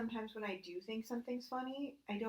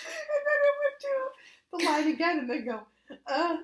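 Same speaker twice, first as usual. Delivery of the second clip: high, loud, half-whispered laughing; crying laughing; 9 semitones higher, 10 dB louder than usual. Real, not acted. Finishing the AI story, crying laughing. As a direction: barely get the words out.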